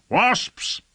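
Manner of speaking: high-pitched yelled order; yelling. A narrator's reading. Lord signals wasp tubes to fire, yelled command. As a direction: cry out the signal to release. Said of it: "yelled Lord Fayed".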